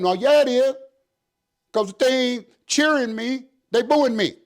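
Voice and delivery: slap down their excuse; indignant retort. Retold or imitated. Captain rebutting officers in his office, heated.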